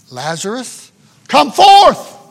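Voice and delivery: high, loud ringing command; commanding call. Retold or imitated. Christ calling Lazarus from the tomb, loud command. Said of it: "at the sound of the Son's voice".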